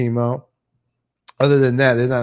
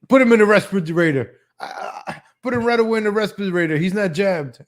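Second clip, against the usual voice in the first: mock doctor; 6 semitones higher than usual. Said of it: "The first question the doctor asks is".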